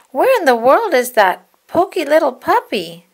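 high, loud puzzled puppy voices; puppies wondering. Acted.

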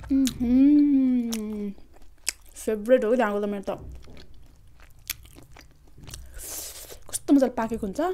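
words muffled by chewing; talking while chewing. Real, not acted. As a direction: mouth partly full of food. Seen 0: Bites chicken at -5; lips pursed, jaw working through speech.

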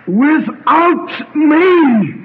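high, very drawn-out preacher's cry; ringing, stretched-out call; commanding proclamation. Retold or imitated. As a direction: declare it slowly with divine authority. Christ's authority, against mortal's version.

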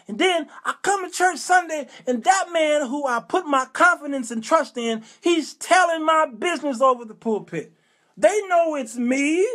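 high-pitched aggrieved complaint; indignant outrage. Acted. Man betrayed by the pastor's pulpit gossip, indignant.

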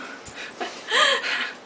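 squeaky unvoiced giggle; a high, airless burst of laughter; high breathless laugh. Real, not acted. Laugh at the blended food.

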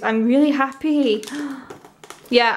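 usual speaking voice

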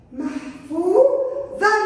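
slow محفوظا ending in "-an"; محفوظا with fath tanween. Shown as a demonstration. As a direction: stretch محفوظا out to an open "-an". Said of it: "كلمة محفوظ مع تنوين الفتح". (محفوظًا بتنوين الفتح ببطء)

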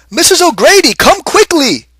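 high loud call across the yard. A narrator's reading. Husband calls his wife from the garden, high and loud. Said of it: "he called".

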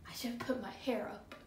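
whispered aside; whispering. Real, not acted. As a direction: whisper to yourself. Muttering in ice bath.

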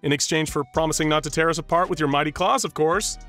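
high, mock-awed humoring. Agent humoring the creature, mock awe. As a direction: play along with the kid.

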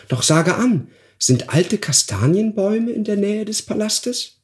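high, bright-voiced goose consoling the dwarf. Goose Mimi comforts the weeping dwarf, high and kind.

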